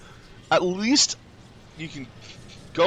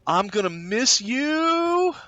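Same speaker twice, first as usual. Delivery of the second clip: higher, slow dramatic mock goodbye; hammed-up farewell; 13 semitones higher, slower than usual. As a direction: wail it out like a silly parting.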